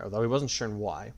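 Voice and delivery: usual speaking voice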